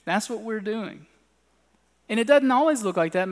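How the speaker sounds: normal talking voice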